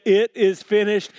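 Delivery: ringing, emphatic quotation; forceful proclamation. Retold or imitated. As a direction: proclaim it with full conviction. Preaches Jesus' words, second time.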